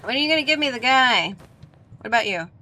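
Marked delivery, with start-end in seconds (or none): 0.0-1.3 excited. Real, not acted. Urgent loud fast demand.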